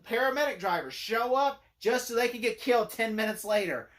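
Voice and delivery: ordinary talk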